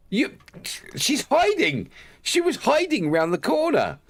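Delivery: very high, incredulous squeal; real high-pitched indignant disbelief; shocked outcry. Real, not acted. Gamer finding her hiding round the corner, shocked outcry.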